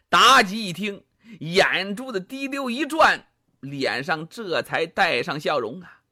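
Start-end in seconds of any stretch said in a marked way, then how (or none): none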